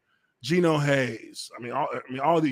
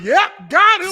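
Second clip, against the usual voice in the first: high, loud mock triumph; gleeful gotcha; 12 semitones higher, 10 dB louder, slower than usual. Host jumps in over the news clip, mock triumphant. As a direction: crow it like a winner.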